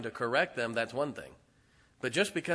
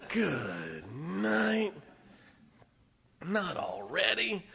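ordinary talk, nothing marked

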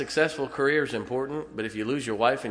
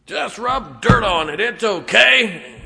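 higher, drawn-out mock shout; big hammed-up tough-love yell; loud dismissive shout; 10 semitones higher, 11 dB louder, slower than usual. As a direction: holler it slowly, brushing off a boo-boo. Acts out brush-off to hurt child.

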